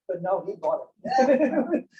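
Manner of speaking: ordinary talk, nothing marked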